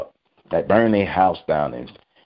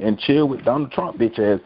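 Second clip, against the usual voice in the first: ordinary talk, nothing marked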